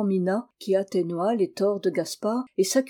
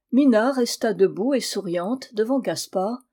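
usual speaking voice

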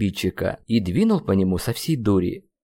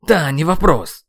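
higher, louder scoff; snorting retort; 6 semitones higher, 5 dB louder than usual. A narrator's reading. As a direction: toss it off huffily. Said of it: "фыркнул я". (фыркающий ответ)

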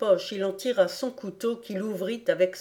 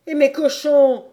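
higher, loud anguished cry; a loud wail of despair; despairing howl; 7 semitones higher, 10 dB louder than usual. Acted. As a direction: howl it, wringing your hands. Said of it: "hurla Reb en se tordant les mains avec désespoir".

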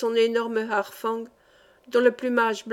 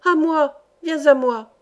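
louder, clear summoning cry; raised, urgent calling voice; clear-voiced call; 4 semitones higher, 6 dB louder than usual. A narrator's reading. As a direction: call the bird to come back. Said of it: "cria-t-elle d'une voix claire".

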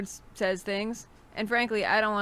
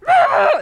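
short, high, loud "Ah!" scream; sudden burst of screaming from an opened tin; mock scream; 15 dB louder than usual. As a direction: let out a piercing pretend shriek. Demonstrates the tin's scream.

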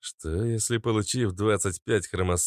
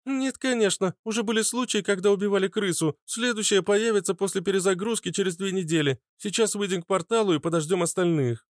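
high-pitched character voice, matter-of-fact; high, brisk-mannered answer; high-voiced heroine, explaining; 12 semitones higher than usual. A narrator's reading.

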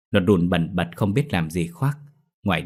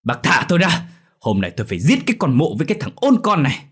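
high, fast angry shout; restrained man raging; struggling in fury; 8 semitones higher, 4 dB louder, faster than usual. A narrator's reading. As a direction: fight to break free, yelling threats. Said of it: "ông Mận lại vùng vằng".

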